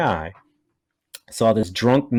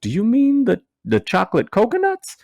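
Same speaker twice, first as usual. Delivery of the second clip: higher, leaning-in confused asking; puzzled question; 10 semitones higher than usual. Retold or imitated. Comedian leaning in to the drunk man, puzzled and higher. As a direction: ask it baffled and close. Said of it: "leaning into him and going".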